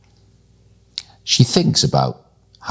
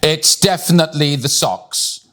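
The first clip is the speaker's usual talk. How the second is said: put-on higher, bright-eyed child's certainty; eager; 9 semitones higher than usual. A narrator's reading. Millie telling her mother over curry, eager. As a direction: declare it high and sure. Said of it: "Millie told her mother".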